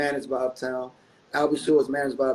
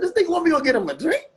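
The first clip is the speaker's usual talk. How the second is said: high, quick, incredulous question; retold outrage, pitched way up and rushed; offended disbelief; 11 semitones higher, 4 dB louder, faster than usual. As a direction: act out comic indignation at being sent on an errand. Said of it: "I almost got offended".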